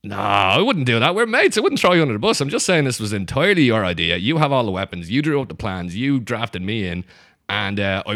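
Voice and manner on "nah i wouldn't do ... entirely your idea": protesting innocence. Acted. Irishman reassuring the mate he betrayed, loud protest. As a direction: deny it hotly to your mate.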